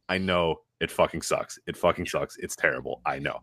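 low, hushed, resigned sigh of agreement. Retold or imitated. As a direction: concede it quietly, worn out. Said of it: "I have just had to say".

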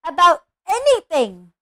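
slow, loud, outraged; growled. A narrator's reading. Hagrid confronts the Dursleys about Harry, growling in outrage. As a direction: build to a bellow on the last word. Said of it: "he growled at the Dursleys".

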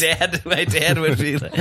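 ordinary delivery

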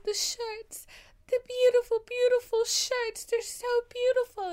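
mock tearful. Quoting Daisy overwhelmed by Jay's shirts, high mock tearful. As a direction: gush like an overwhelmed heroine about to cry. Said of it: "And then she starts crying".

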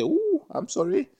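high, squealing fake-sorry "ooh"; mock apology. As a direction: squeak out an overdone apology like a scolded kid. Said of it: "you say".

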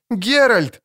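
put-on high girl's voice calling his name; a little girl calls out, overjoyed. A narrator's reading. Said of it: "повторяла девочка".

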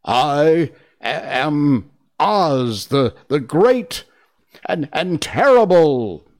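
frightened stammer, catching on words; trembling voice. A narrator's reading. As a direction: falter over the grand title. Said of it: "in a trembling voice".

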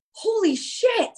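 high-pitched, stunned exclamation; retold astonishment. Reacting to a song that comforted her, retold astonishment. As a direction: let the pitch leap up in disbelief.

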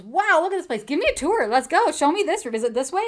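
bossy, thrilled tour demand; Andy taking charge, excited and loud. Retold or imitated. Andy takes control touring place.

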